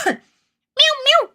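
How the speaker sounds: very high squeaky meows; high playful cat meowing. Pretend. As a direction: mew like a tiny kitten.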